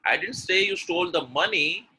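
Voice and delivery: "money" high and stretched; stress on money. Shown as a demonstration. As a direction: lean on "money" as the wrong item. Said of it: "but you stole something else".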